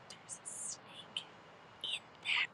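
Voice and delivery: very slow, barely audible whisper; hushed, trying to stay chill. Retold or imitated. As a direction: whisper it slowly, imitating someone hiding alarm from kids. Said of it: "trying to be chill".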